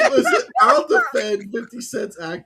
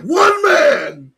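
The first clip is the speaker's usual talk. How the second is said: mock shout; 11 semitones higher, 9 dB louder than usual. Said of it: "He's like".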